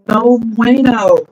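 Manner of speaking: loud, drawn-out no bueno; exasperated no bueno. Real, not acted. Streamer giving up on her broken audio, exasperated and loud. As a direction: let the frustration out, slow.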